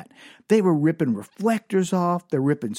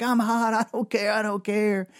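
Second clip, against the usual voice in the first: whiny refusal; 5 semitones higher than usual. Retold or imitated.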